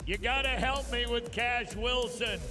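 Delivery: normal talking voice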